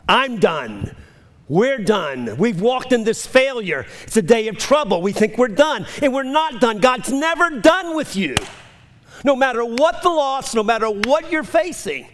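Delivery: high, driving sermon delivery; rising pulpit fervor; impassioned preaching. Real, not acted. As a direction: preach it hard, climbing in pitch. Preaches at full force.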